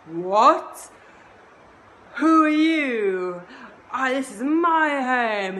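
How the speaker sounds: high, loud, furious shout; enraged Shiva. Acted. Shiva shouting at the boy blocking his home, furious. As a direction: roar it at the boy in outrage. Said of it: "he gets in a rage".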